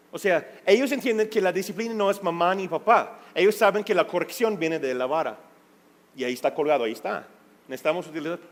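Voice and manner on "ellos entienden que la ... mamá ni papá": ordinary talk, nothing marked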